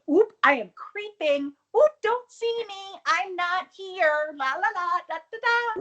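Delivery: high sing-song mock sneaking; stage cat burglar. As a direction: play an exaggerated cartoon cat burglar. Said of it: "like, a cat burglar".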